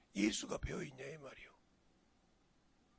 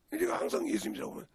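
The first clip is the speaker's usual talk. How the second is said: usual speaking voice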